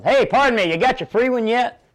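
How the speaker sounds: very high, loud barker yell; yell across. Retold or imitated. Barker yelling across the midway to a passerby. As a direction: holler it across the whole midway. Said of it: "and yell across".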